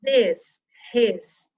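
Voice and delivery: "Desrespeito" slowly, as "Des, res, pei, to"; syllable by syllable. Shown as a demonstration. Teacher showing S and R in separate syllables, "Desrespeito" slowly split. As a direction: chop "Desrespeito" into four slow, separate syllables. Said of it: "O S tá aqui na sílaba dele".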